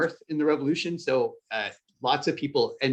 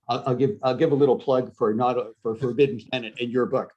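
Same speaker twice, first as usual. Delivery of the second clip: usual speaking voice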